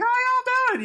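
very high mock-Southern greeting; Southern cowboy accent. Co-host echoes cowboy's greeting, high Southern accent. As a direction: squeal out a cartoon Southern drawl. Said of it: "a real Southern accent".

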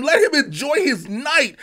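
ordinary talk

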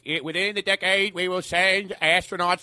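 a hint of JFK speech cadence; JFK impression. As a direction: quote JFK with a mild Boston lilt. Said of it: "you know how J F K was like".

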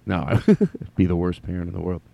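normal talking voice

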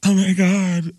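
put-on high-pitched mean-girl gasp; mock mean girl. Radio host imitating mean girls, mock high girly voice.

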